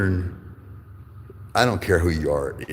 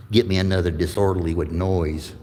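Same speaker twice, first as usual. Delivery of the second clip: ordinary talk, nothing marked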